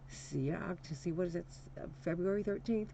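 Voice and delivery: ordinary delivery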